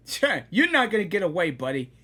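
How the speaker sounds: usual speaking voice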